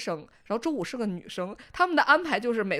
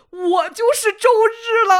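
dying Saturday voice; 11 semitones higher, 12 dB louder, slower than usual. Retold or imitated. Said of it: "周六说".

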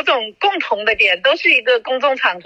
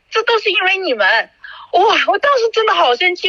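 high, heated, indignant rant; angry rant; 6 semitones higher than usual. Real, not acted. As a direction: pitch up and pour out the grievance. (情绪激动，高声愤慨地数落)

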